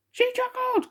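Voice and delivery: high, whimpering protest; crying out. Acted. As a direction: wail it in disbelief. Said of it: "cried the king".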